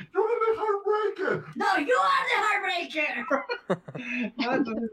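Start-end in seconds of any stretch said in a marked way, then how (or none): 0.1-3.1 much higher, strained mock shout; mock yelling from the back. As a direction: yell it like a fan from the crowd. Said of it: "otro güey le grita desde el fondo". (grito burlón desde el fondo, muy agudo)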